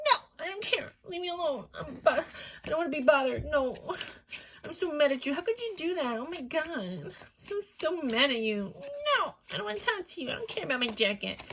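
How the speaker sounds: high, whiny mock tantrum; girlfriend in a fight. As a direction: play a fed-up girlfriend being chased. Girlfriend's angry reply in retold sketch.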